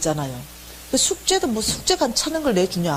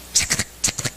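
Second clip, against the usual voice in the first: whispery clicking of film frames; voiceless mouth-made projector clicks; imitated film clicking; 4 dB quieter than usual. Imitating film frames flicking past. (필름 넘어가는 소리를 숨소리로 흉내)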